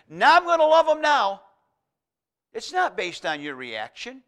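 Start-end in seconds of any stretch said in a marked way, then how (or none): 0.1-1.4 loud, high-pitched mock announcement; mock declaration. Preacher acting out what God doesn't say, loud mock declaration. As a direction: burst out loudly in mock decision.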